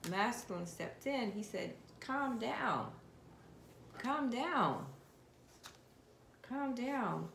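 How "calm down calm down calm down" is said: mock calming. Voicing masculine calming karmic.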